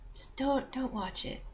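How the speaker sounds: whispered plea; hushed, very soft don't watch it. Real, not acted. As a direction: drop to a whisper and beg. Pleading end of rant.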